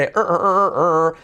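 growled er er, a held English R; drawn-out English R. Shown as a demonstration. Teacher drilling the English R sound for the class. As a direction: hold the R sound, er er. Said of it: "Letra clave R".

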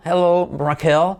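Spanish name in an English accent, drawn out; acento nativo inglés. Shown as a demonstration. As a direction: greet like an English native mispronouncing Raquel. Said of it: "un nativo pronuncia un nombre español mal pronunciado". (saludo con acento inglés, alargado)

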